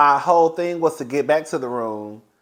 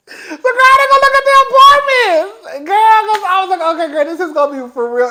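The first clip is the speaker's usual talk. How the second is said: high, loud laugh-crying; half laughing, half wailing; 11 dB louder than usual. Real, not acted. Laugh-cry exclamation.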